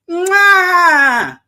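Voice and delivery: loud, high mock chef's kiss. Preacher hams up Italian chef kiss over beauty.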